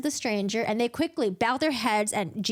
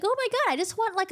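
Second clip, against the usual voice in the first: much higher, eager gush; mock eager; 10 semitones higher, faster than usual. Feyre sneaking out for a snack: mock eager gush. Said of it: "be like".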